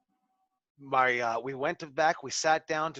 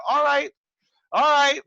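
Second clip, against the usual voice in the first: exasperated; 11 semitones higher, 8 dB louder than usual. Retold or imitated. Exasperated giving in to Jake.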